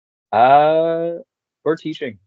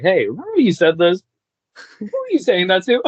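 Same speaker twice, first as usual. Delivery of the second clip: high, incredulous questioning; mock-accusing, louder and higher; mock confrontation; 9 semitones higher, 5 dB louder than usual. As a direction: playfully call your friends out, pitched up. Acts out confronting his Italian friends.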